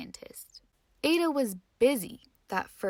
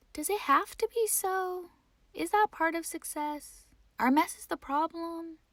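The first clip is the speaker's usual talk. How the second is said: put-on higher, musing little girl; lightly voiced wondering child; 7 semitones higher than usual. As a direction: ponder it aloud. Ada thinking to herself.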